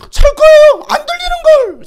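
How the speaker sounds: very high, loud yelling; piercing, full-force exclamation; exasperated shout. Real, not acted. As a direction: yell as if nobody can hear you. Shouting as if into sleeper's ear.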